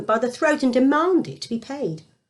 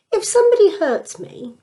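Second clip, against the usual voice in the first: eager question; 9 semitones higher, 5 dB louder than usual. Acted. Peter asking eagerly.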